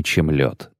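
ordinary delivery

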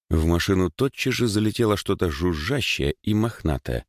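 plain reading voice

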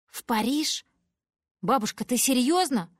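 high, loud disbelief at the news; astonished. Acted. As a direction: gasp it out, can't believe it. Stunned by Paris offer. (изумлённо, громко и высоко)

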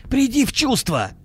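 high-pitched imploring cry; urgent exclamation. A narrator's reading. Friend under attack pleads, crying out urgently. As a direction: call him back to his senses. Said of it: "воскликнул Акимов".

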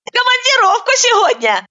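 can't hide her delight about the husband; openly joyful. A narrator's reading. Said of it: "не скрывая радости".

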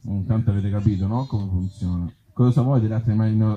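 normal talking voice

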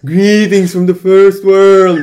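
slow, hammed-up ghostly moan; spooky ghost voice. Shown as a demonstration. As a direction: drag it out like a haunting spirit. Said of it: "tried reading the entire paragraph in spooky ghost voice".

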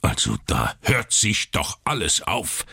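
mild exasperated outburst; understated irritated doctor. Acted. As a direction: let the annoyance burst out. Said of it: "rief Doktor Keene".

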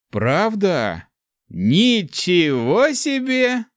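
high, drawn-out fake wow; mock amazement. A narrator's reading. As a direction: exclaim in hammy awe. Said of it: "воскликнул бухарец". (наигранное изумление)

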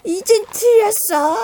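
high, slow despairing wail; wailing cry. Acted. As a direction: cry out that all is lost. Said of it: "가부가 울부짖었습니다". (울부짖듯이)

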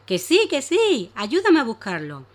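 insist, drawing it out; insistent little dragon. Acted. Danilo insists.

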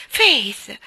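loud cry of her name in terror; frightened cry. A narrator's reading. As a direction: call out Faith, alarmed. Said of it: "gridò Lois in preda al terrore".

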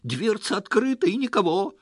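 high, loud agitated tirade; worked-up, high-pitched outburst; spiteful shouting. A narrator's reading. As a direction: shout your defence, voice pitched right up. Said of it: "злобно закричал он".

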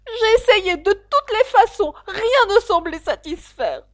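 high, loud, half-sobbing complaint; sob-like pigeon. Acted. Pigeon lamenting to Alice, near a sob. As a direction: wail it like a weary bird close to tears. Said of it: "avec une espèce de sanglot".

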